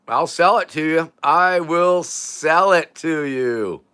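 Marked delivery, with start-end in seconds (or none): 1.2-3.8 every word stretched slowly; slow, grandiose promise; drawn-out vow. Pretend. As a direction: draw out "I will sell it to you" word by word.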